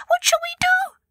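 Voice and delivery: very high, squealing mouse voice; high-pitched mouse panic; horrified mouse squeal. A narrator's reading. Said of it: "the mice squealed in horror".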